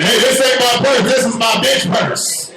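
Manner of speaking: high-pitched defensive announcement; protesting old dude. Retold or imitated. Old dude holding a purse tells everyone, defensive. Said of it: "And they just saying".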